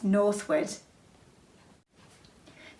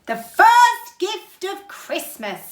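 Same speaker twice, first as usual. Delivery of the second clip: slow, louder proclamation, pitched a little up; called out; 6 semitones higher, 4 dB louder, slower than usual. Acted. Santa holding up the bell for the elves, slow loud call. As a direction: announce it grandly to the crowd. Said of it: "called out".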